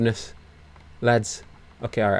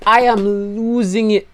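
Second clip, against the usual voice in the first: losing it; 8 semitones higher than usual. Real, not acted. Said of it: "the descent into madness I'm experiencing".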